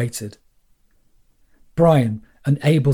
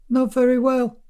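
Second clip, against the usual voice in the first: high, squeaky little voice; 12 semitones higher than usual. Acted. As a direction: squeak it out timidly. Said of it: "he said in a squeaky voice".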